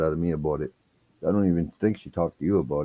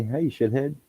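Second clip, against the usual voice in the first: higher put-on clerk voice; imitated clerk greeting; 9 semitones higher, slower than usual. Clerk turns to him with the nickname, higher mimicked greeting.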